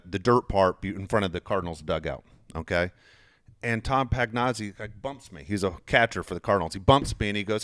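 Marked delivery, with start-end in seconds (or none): none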